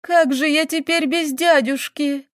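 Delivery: high, slow tearful wail; sobbing. A narrator's reading. Girl asks how she'll live without uncle, wailing tearfully. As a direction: wail it, acting out sobs. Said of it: "и разрыдалась".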